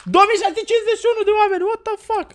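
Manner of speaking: fast and high, disbelieving; stunned outburst. Real, not acted. Reacting to the viewer count, stunned and fast. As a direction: blurt it out in shock.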